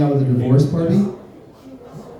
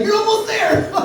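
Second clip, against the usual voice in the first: high squeaky cheering voice, much higher; mock cheer. The tampon string cheering her on, high. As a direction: cheer it on like a tiny party popper. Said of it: "It's like".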